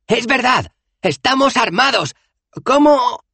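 high, loud calling out; shouted backing. A narrator's reading. Dos Flores backs up Bethan's threat, high and loud.

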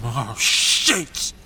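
ordinary talk, nothing marked

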